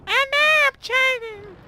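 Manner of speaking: very high, slow squeaky mock voice; mock whiny voice. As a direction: voice the enemy tank in a silly squeal. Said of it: "It's like".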